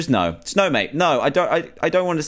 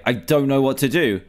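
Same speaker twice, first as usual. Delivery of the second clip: normal talking voice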